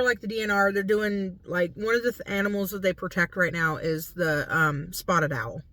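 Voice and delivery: ordinary delivery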